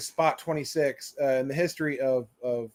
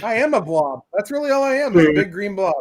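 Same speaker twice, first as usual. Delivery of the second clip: mock despair; 4 semitones higher, 6 dB louder than usual. Mock self-pity joke.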